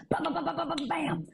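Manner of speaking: bam sound effect. Acted. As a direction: hammer out each bam. Superman sound effect in joke.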